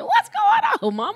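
squeaky high laughing quote. Retold or imitated. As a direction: laugh through mock-innocent question. Laughing at his own misreading.

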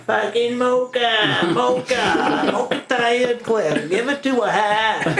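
high, slow slurred mock Maine drawl; Maine raker voice. Imitating the bucket brigaders to the hosts, slow Maine drawl. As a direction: slur it like a lazy rake crew. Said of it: "they'd be like".

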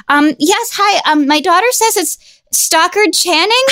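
high, hesitant caller voice; tentative, pitched well up; nervous voice. Retold or imitated. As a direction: imitate a nervous mom phoning a radio show. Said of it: "she's got this nervous voice".

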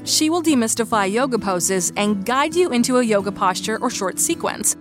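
ordinary delivery

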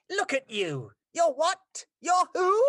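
mock him like a spiteful pack animal; mean hyena voice. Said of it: "That was my hyena for you".

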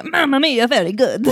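a playful fake Italian accent; tossing off a jokey Italian accent; mock Italian. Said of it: "I would say".

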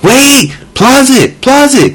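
put-on very high-pitched, urgent little-kid plea; imitated small child. Child to parent leaving for the restroom, imitated urgent plea. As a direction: pipe up like a child stopping a parent.

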